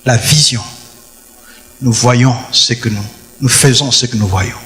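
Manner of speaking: ordinary talk, nothing marked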